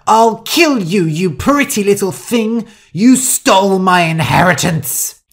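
high, loud furious shouting; enraged. Acted. Rosa jumping onto Shu over the inheritance, enraged shout. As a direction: rage at full force.

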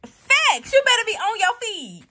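high, loud hype; hyped cheer. Real, not acted. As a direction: cheer her on like a fan. Cheering a character on.

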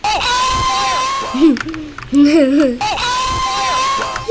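loud shrieking laughter; screaming laugh. Real, not acted. Streamer shrieks with laughter at the game, loud laughing scream. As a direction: howl with laughter, half crying. Wild reaction to game event.

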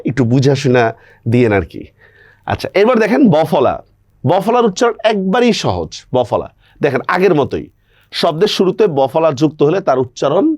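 ordinary talk, nothing marked

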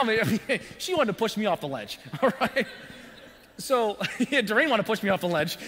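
laughing through the words; chuckling while telling it; real laughter bubbling into the lines. Real, not acted. Laughing at own story.

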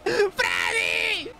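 high panicked squeals; scared squealing. Real, not acted. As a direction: shriek in terrified laughter. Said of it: "Tengo miedo".

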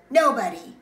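loud, high, abrupt answer; defiant refusal. Acted. Child turns down a bedtime story, loudly defiant. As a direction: fire it back as a stubborn refusal.

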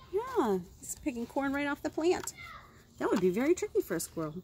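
ordinary delivery, nothing marked

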